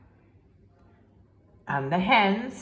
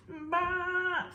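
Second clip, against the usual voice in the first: sheep bleat; 12 semitones higher, 4 dB quieter than usual. Acted. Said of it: "said the sheep".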